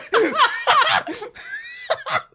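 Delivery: shrieking laughter; high cackling laugh. Real, not acted. Host cackles at own story, high laughter. After the punchline.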